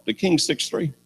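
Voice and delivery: ordinary talk, nothing marked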